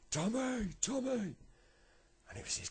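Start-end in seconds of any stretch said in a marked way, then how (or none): 0.1-1.3 very high, loud sing-out call. Acted. Granddad hails Tommy across the road, calling out. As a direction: call his name across the road, falling at the end.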